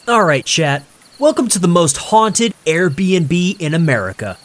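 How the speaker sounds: loud, high, grinning hype; hyped streamer greeting. A narrator's reading. Streamer hyping his chat on camera, loud and grinning. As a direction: pump up the live chat like a showman. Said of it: "he said, grinning".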